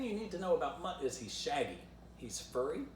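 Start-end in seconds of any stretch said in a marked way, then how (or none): none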